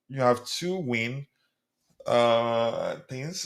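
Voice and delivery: slow, halting "two win" read literally as numbers; puzzled misreading. Shown as a demonstration. Host struggling to decode a viewer's abbreviated chat. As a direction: sound out "two win" like it makes no sense. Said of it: "You have to win things".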